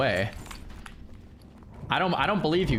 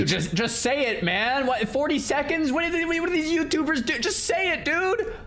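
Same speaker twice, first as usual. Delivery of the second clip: raised, exasperated pleading to just say it; impatient; 12 semitones higher than usual. Real, not acted. Streamer pleading with the video guy, exasperated and higher. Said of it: "impatient at video".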